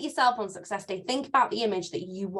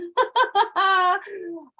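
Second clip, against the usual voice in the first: squeaky giggle at own voice; high squeaky laugh; 10 semitones higher, 5 dB louder than usual. Real, not acted. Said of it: "I sound like I swallowed helium".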